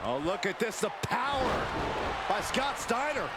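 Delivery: normal talking voice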